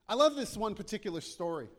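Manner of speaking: normal talking voice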